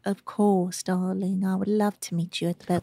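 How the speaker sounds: soft, lightly voiced British gent; light British accent. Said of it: "his voice is a little lighter".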